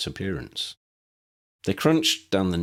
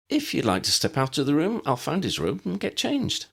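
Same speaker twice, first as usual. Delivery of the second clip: put-on higher young woman, brisk and teasing; young woman's voice; 8 semitones higher than usual. Ginny correcting Dennis about her teacher, higher teasing voice. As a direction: put the girl's voice up and keep it light.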